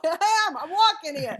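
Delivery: hammed-up Bronx "I'm walking here"; Bronx accent. Guest mimics a Bronx local, hammed-up Bronx accent. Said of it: "nobody loves clowns like the Bronx".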